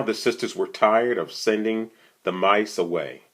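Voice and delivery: usual speaking voice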